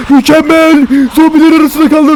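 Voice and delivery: very high, loud shriek of delight; shrill, over-the-top cheering; excited shouting. Real, not acted. Cheers as zombies get trapped.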